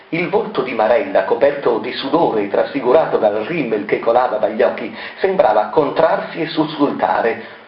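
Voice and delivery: ordinary delivery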